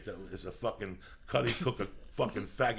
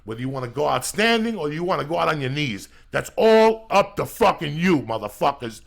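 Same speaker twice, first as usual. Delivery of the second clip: much louder, higher announcer-style rally cry; hype shouting; 4 semitones higher, 12 dB louder than usual. Real, not acted. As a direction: whip the listeners into a frenzy. Shouted hype show outro.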